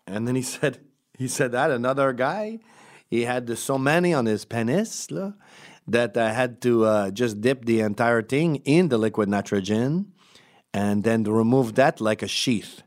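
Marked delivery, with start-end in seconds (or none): 1.5-12.8 thick put-on French Canadian; French Canadian accent. Doctor describing another patient's treatment, French Canadian accent. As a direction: Quebecois doctor telling a case story. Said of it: "Hold on, French Canadian".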